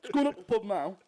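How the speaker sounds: loud, high mock British accent without "the"; accent dropping "the". Mocking accents that shorten the word the, loud put-on accent. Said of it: "any accent that shortens the word the".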